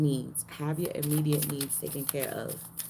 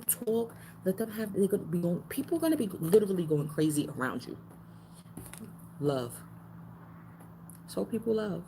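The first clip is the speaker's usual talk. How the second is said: ordinary talk, nothing marked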